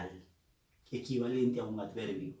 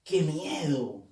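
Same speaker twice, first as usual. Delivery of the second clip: exclamation; slower than usual. Shown as a demonstration. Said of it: "oraciones exclamativas".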